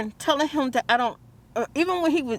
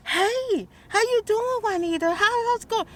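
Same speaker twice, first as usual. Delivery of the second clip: much higher, bright imitation of the man's usual hello; cheerful usual greeting; 10 semitones higher than usual. Imitating how the repairman usually greets Juanita, high and cheery. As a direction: mimic his normally friendly welcome, voice up high. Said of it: "normally it's like".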